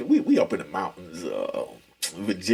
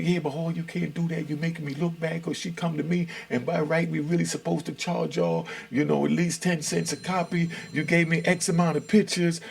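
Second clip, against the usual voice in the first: pleading case manager; 7 semitones higher than usual. Retold or imitated. Voices case manager's retold plea.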